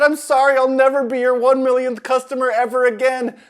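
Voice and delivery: high, loud panicky apology; panicked apology. A narrator's reading. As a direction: blurt it out in alarm.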